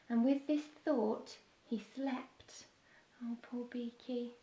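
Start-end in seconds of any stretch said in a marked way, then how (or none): 1.6-4.3 whisper. A narrator's reading. Narrator hushes as Beaky sleeps.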